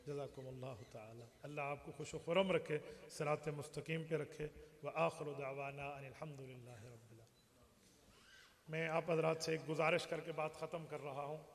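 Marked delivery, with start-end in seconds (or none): 0.1-8.7 low, soft blessing; preacher's quiet, lowered sign-off prayer; closing prayer. Real, not acted. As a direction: wind down into a hushed benediction.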